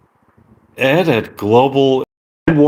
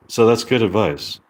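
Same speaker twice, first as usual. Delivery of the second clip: ordinary talk, nothing marked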